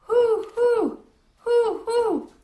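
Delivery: high, loud hooting owl call out of the dark; mysterious owl hoot. A narrator's reading. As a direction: hoot like an unseen owl, eerie and drawn out. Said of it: "the voice was strange and mysterious".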